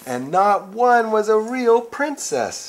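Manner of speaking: high, slow, loud lament; dramatic lament. Acted. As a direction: wail it like a heartbroken prince. Prince despair, slow.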